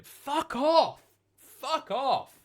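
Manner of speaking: high-pitched exclaimed outburst; mock outrage. Real, not acted. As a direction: protest loudly, voice shooting right up. Mock outrage at chat's joke.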